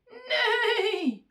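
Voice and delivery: high, loud whinnying neigh; long, falling horse cry; horse's neigh. A narrator's reading. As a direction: neigh with all your might. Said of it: "Then the goose said".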